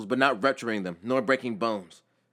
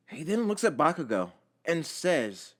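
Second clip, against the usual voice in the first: ordinary delivery, nothing marked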